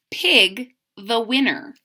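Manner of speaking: ordinary delivery, nothing marked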